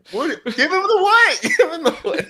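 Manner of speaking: high, loud, laughing confusion; baffled laughing what. Retold or imitated. To friends, replaying the movie gag, laughing bafflement. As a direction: ask it like you can't believe the word. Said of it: "and they're like".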